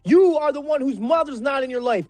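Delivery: high, heated tirade hurled at Ryan; furious rant. Real, not acted. Ranting at Ryan over his slander, furious and high. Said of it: "that was strong".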